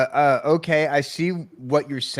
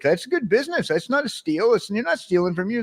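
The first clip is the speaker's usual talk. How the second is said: retorting to critics; 7 semitones higher than usual. Retold or imitated. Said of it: "I'm like".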